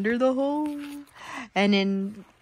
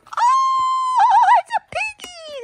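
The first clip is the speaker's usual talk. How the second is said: delighted surprise; 5 dB louder, slower than usual. Acted. Mother finding the piggy, high playful surprise. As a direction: squeal of discovery for the child. Flap reveals the pig.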